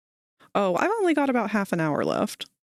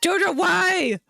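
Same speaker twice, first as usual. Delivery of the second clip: mock outcry; 9 semitones higher, slower than usual. Real, not acted. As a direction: cry out at the chat user. Chat follower posts something.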